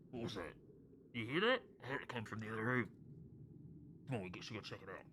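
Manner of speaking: hushed voice through a closed door; muffled. Acted. Game master voices someone beyond the door, muffled. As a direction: speak low and indistinct, as if overheard. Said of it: "you hear muffled".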